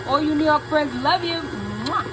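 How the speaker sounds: excited shout-out. Real, not acted. Calling out to New York fans.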